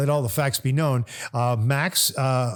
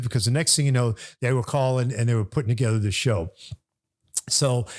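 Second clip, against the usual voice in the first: ordinary delivery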